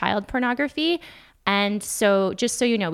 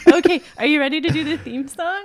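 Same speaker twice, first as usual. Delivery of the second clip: excited; 5 semitones higher, 5 dB louder, faster than usual. Real, not acted. Laughing, launching theme song.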